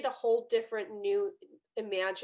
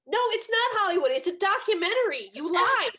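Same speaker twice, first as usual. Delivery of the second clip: higher and louder, exasperated protest; indignant outburst; 10 semitones higher, 8 dB louder than usual. Retold or imitated. Daughter rebuking her father for lying about the documentary, loud and indignant. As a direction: call out the lie, voice jumping up loud.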